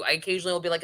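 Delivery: ordinary talk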